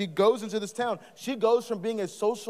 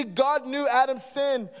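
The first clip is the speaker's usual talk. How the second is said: usual speaking voice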